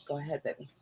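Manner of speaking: usual speaking voice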